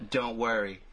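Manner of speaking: usual speaking voice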